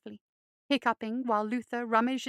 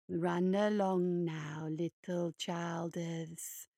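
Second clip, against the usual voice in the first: low, slow, toneless hare voice; low slow toneless send-off; toneless monster; 5 semitones lower, 5 dB quieter, much slower than usual. Acted. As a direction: dismiss them without feeling. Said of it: "he said tonelessly".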